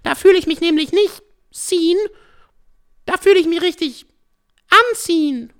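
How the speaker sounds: high synthetic AI voice; robot voice. Acted. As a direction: speak like an artificial assistant. AI voice character throughout.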